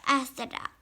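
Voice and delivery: ordinary delivery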